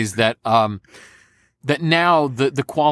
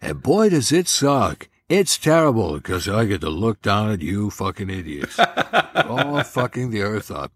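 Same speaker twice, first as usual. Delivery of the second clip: George Carlin; 2 semitones lower than usual. Acted. Dead comedian scolding people from heaven, caustic impression. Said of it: "I did George Carlin for that".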